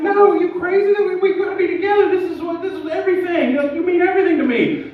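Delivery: high desperate begging; pleading. Retold or imitated. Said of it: "I'm like".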